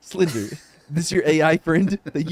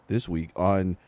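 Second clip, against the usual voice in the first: low, deadpan monotone; mock monotone host intro; Chad impression; 9 semitones lower, 3 dB quieter, slower than usual. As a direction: drop the voice low, little inflection. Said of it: "trying to do for my impression".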